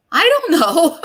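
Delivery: high, loud-ish helpless blurt breaking into a laugh; flustered panic. Retold or imitated. Caller retelling her answer to the operator, flustered. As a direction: throw it out high, at a loss, half laughing.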